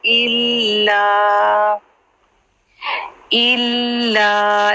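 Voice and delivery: इल्ला with a doubled ल held, slow; Qur'anic recitation. Shown as a demonstration. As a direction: hold the ल in इल्ला. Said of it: "लाम को तशदीद है".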